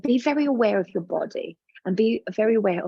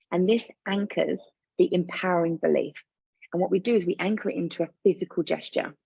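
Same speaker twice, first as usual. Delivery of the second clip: usual speaking voice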